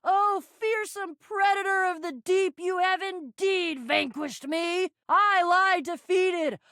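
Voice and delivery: slow, hammed-up theatrical supplication; grandiose grovelling. Begging mercy from a mighty villain, theatrically abject. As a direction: declaim it slowly like an overblown plea. Mock grovelling plea to the pirate.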